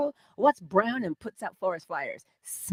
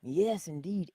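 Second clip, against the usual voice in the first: ordinary talk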